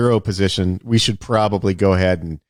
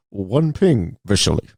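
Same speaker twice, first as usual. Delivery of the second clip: mock Russian accent; 2 semitones lower, slower than usual. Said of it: "Hunt for Red October Russian".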